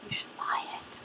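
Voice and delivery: whispered aside to camera; sneaky whispered plug. Real, not acted. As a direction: hush it like a secret tip. Aside pushing the book.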